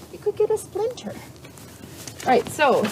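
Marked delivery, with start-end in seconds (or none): 0.1-1.1 high, soft pet voice. Real, not acted. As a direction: coo it to the horse. Baby talk to the horse.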